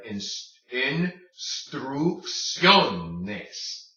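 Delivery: instrucciones very slowly, een-strook-syoh-nes; drawn-out teaching instrucciones with hard O; syllable by syllable. Shown as a demonstration. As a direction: separate and stretch each syllable of instrucciones. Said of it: "say that O hard".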